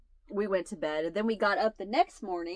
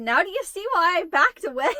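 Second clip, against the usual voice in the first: high, loud, laughing told-you-so; laughing retort; 9 semitones higher, 7 dB louder than usual. Retold or imitated. Teasing her dad after the beer hit him, laughing loudly. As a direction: crow it through laughter. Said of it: "I said".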